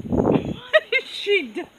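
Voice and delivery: high laughing squeal of disbelief. Real, not acted. Owner laughing at her goat standing oddly, laughing high. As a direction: laugh the words out as your voice jumps up. Owner startled by goat's pose.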